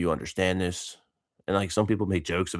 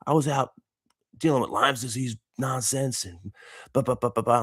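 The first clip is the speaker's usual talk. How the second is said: high, cheery imitation of a gym buddy; upbeat high-pitched retold chatter; happy greeting; 7 semitones higher than usual. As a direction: imitate a happy guy saying hello. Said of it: "he's all happy".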